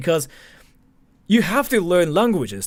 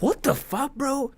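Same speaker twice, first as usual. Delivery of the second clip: much higher but softer, an odd strained voice; hushed disbelief; 10 semitones higher, 4 dB quieter than usual. Acted. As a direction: breathe it out in stunned disbelief. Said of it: "It's like".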